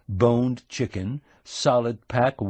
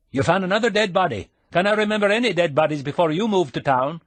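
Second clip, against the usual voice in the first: fast, higher teasing Scottish brogue; 8 semitones higher, 3 dB louder, faster than usual. A narrator's reading. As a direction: needle him in a quick Scottish brogue. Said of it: "putting on the brogue".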